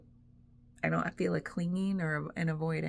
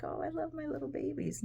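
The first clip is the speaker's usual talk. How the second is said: put-on high, cooing baby talk, much higher; mock doting voice; 10 semitones higher, 5 dB quieter, faster than usual. Mocking her old attachment to her things, high cooing. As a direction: coo over the stuff like it's a baby.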